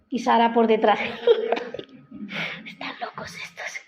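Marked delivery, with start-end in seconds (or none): 1.0-1.8 a mock laugh of doubt; Sara's laugh. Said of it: "Sara se rió". 2.7-3.8 whispered aside under the breath; a mock whisper of disbelief; muttered aside. Sara mutters behind the angels.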